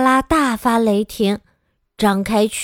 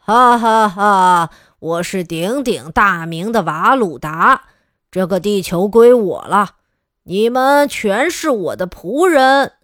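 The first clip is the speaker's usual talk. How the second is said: villain laugh; 3 semitones lower than usual. Acted. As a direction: gloat like a cartoon alien invader. Alien villain announces conquest.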